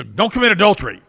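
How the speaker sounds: mock-preachy loud rule; emphatic rule. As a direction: announce the rule like a commandment. Retold chat, listing rules.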